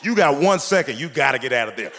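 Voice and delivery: ordinary talk, nothing marked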